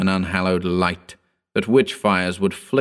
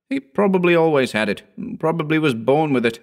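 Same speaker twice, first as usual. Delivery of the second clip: higher-pitched offhand explanation; casual, raised-pitch dismissal; dismissive shrug; 8 semitones higher than usual. A narrator's reading. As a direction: wave the question away. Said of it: "Howard shrugged".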